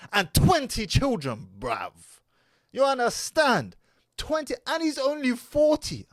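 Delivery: loud, high, incredulous delivery; amazed emphasis. Real, not acted. Amazed recounting of surprising family size.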